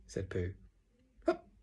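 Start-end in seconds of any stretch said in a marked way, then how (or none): 1.2-1.5 small sudden "hup"; hiccup. A narrator's reading. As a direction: let a little hiccup slip out. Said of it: "said Roo again".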